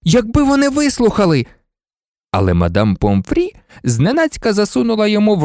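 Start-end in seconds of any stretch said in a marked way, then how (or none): 0.0-1.5 put-on high, heated boy's protest; angry outcry at a high pitch; furious exclamation. A narrator's reading. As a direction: burst out in frustrated anger. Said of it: "розлючено вигукнув Гаррі".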